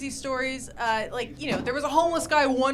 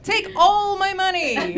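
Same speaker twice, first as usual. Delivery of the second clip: won-over buyer; 7 semitones higher, 7 dB louder, slower than usual. Acted.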